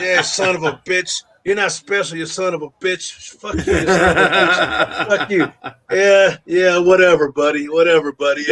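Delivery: hammed-up cranky squabbling, played for laughs; mock old-guy yelling. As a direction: imitate old guys bickering in a feeble fight. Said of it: "Old guys just yell at each other".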